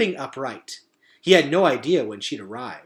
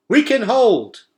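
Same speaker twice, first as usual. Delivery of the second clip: high, loud defiant cry; 11 semitones higher, 10 dB louder, slower than usual. A narrator's reading. Merith answering Henry in battle, defiant shout. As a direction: shout back that you can hold. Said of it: "cried Merith".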